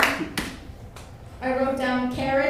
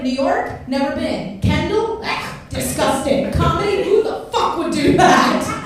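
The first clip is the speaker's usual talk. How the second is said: haughty, pitched up and louder, sing-song; dismissive mock persona in the elevator; snooty different person; 4 semitones higher, 6 dB louder than usual. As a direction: pretend to be a disdainful stranger. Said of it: "I was like".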